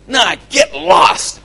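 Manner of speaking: brusque rejection. Acted. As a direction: shout them away, stretched out. Acts out a brusque refusal.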